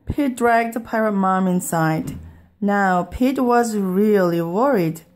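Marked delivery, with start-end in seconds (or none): none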